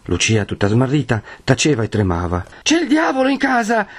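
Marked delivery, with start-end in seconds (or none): 2.6-3.8 put-on high, frightened boy's voice; Menico, panting alarm. A narrator's reading. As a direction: blurt it out high-pitched and scared. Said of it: "riprese Menico ansante". (voce acuta, allarmata, di ragazzo)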